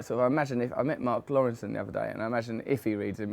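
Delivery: normal talking voice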